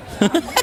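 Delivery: high teasing laugh. Acted.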